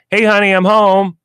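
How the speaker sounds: loud, high homecoming call. Retold or imitated. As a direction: sing out a greeting to the house, imitating a neighbor. Retold neighbor calling out on arrival.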